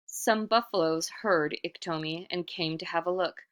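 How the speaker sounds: usual speaking voice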